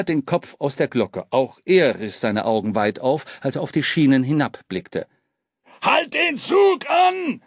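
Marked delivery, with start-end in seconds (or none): 5.8-7.4 high, stretched-out yell; urgent high-pitched holler; screamed order. A narrator's reading. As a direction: call out in alarm, every word drawn long. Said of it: "schrie Dampfi noch einmal".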